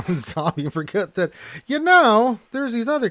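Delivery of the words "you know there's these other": high, loud protest; mock exasperation. Host nagging the game AI about other targets, mock-exasperated. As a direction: plead loudly, pitched way up.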